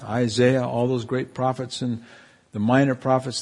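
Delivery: normal talking voice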